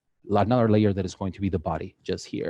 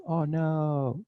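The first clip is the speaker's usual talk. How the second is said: mock scared; 5 semitones higher, 5 dB quieter than usual.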